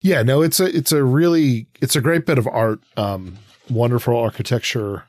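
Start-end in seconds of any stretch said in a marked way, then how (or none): none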